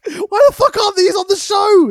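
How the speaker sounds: loud exasperated outburst; exasperated shout. Real, not acted. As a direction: shout it in disbelief. Outraged at missing matches.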